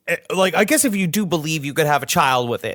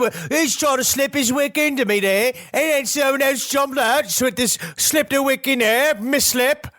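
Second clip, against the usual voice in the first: high-pitched, hammed-up Australian-Cockney babble; bad Australian accent, Cockney-sounding; 8 semitones higher than usual. Ghost father explaining who slipped in, bad Australian-Cockney accent. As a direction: protest in a shrill, botched Australian-Cockney accent. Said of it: "that's Cockney".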